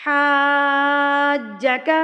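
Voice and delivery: حاجک with the first vowel held very long, four counts; long madd. Shown as a demonstration. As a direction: stretch the ا in حاجک slowly. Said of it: "میرے ساتھ ساتھ پڑھیے". (حاجک میں مد کو چار حرکات کھینچیں)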